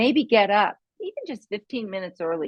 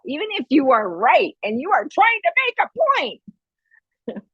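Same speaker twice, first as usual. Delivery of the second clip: higher, building to an insistent yell; mock yelling; 8 semitones higher, 3 dB louder than usual. Shown as a demonstration. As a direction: demonstrate yelling to force a point across. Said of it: "when you're yelling".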